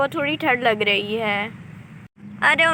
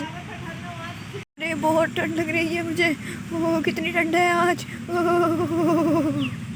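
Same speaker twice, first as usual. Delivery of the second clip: shivering with cold; 4 semitones higher, 3 dB quieter, much slower than usual. Acted. Mother wrapped in a blanket, freezing.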